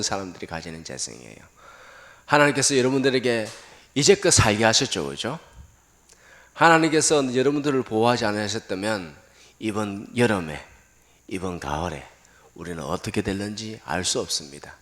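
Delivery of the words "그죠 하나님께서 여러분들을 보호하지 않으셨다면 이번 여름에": normal talking voice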